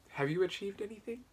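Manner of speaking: usual speaking voice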